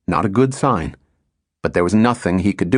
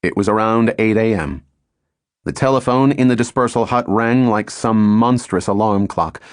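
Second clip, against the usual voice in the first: ordinary delivery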